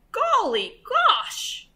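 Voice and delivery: high, drawn-out exclamation; startled farmer. A narrator's reading. Farmer wakes to the commotion, high startled cry. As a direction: exclaim slowly in high surprise. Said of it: "the farmer woke and said".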